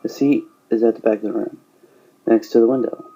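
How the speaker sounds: plain reading voice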